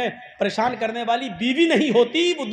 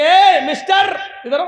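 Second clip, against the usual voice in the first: calling out; 6 semitones higher, 5 dB louder than usual. Acted. Caliph calling the departing man back, loud call. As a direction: shout him back, higher and loud.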